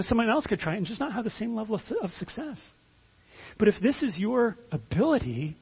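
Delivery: usual speaking voice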